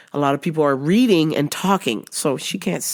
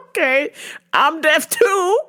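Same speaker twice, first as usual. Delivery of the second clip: sassy comeback; 11 semitones higher than usual. Retold or imitated.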